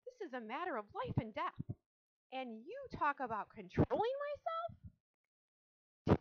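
high, outraged, building to a drawn-out cry; indignant yell. A narrator's reading. Girl arguing life and death with her father, yelling. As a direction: protest loudly, stretching the final words. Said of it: "yelled Fern".

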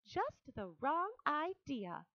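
gently but firmly shut the idea down; Charlotte, firm. A narrator's reading. Charlotte rejecting the rat's word, slow and high. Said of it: "replied Charlotte".

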